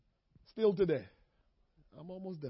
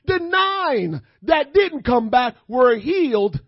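usual speaking voice

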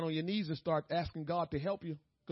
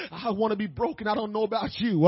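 usual speaking voice

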